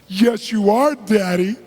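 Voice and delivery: put-on high, loud, drawn-out childlike wail; crying little daughter. Five-year-old begs Daddy to live, mock tearful wail. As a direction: plead like a heartbroken little girl, acted. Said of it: "She starts crying hysterically".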